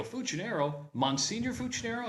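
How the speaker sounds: imitating a stranger's high, questioning surprise; surprised recognition. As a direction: echo the name high, in mild disbelief. Retold couple recognises the surname.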